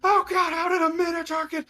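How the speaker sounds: very high, loud panicked cry; panicked scream. Acted. Soldier screaming as the Minotaur attacks, high panic. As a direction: shriek it like a soldier being trampled. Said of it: "and just hear".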